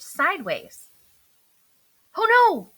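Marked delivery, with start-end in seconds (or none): 2.1-2.7 high, loud startled gasp; gasped alarm. A narrator's reading. Earl alarmed as the ship lurches, a high gasp. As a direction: catch your breath in alarm. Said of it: "he gasped".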